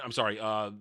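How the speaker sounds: ordinary talk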